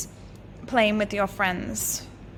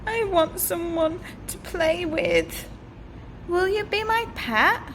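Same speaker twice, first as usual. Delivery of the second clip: higher, lightly voiced princess; a hint of a cheerful little princess; eager princess; 7 semitones higher than usual. The princess asks the dragon to be her pet, lightly higher.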